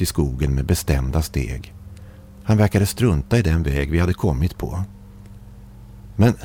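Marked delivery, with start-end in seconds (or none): 6.2-6.3 hesitant, higher-pitched question; mild narrated stammer with a halting start. A narrator's reading. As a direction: falter after the first word, then ask. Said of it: "stammade jag".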